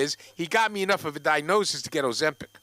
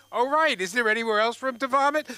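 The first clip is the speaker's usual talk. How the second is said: higher, incredulous protest; indignant question; 7 semitones higher, 4 dB louder than usual. Retold or imitated. To the Subway girls, incredulous and indignant. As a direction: demand it with disbelief.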